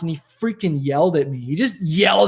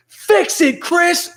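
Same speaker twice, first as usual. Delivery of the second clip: boss yelling; 10 semitones higher, much slower than usual. Retold or imitated. Boss yells at young Chris over the phone, angry shout. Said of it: "he's like".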